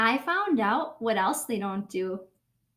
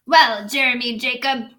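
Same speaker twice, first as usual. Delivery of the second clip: louder, lightly voiced captain; mild hearty pirate; 7 dB louder than usual. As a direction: address the boy like a bluff captain. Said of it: "he said".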